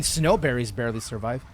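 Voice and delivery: ordinary delivery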